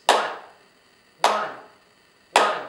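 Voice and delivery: ONE on each clap, evenly spaced; one-beat count. Shown as a demonstration. Teacher demonstrating one syllable, steady clapped count of ONE. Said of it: "one syllable that sounded like this".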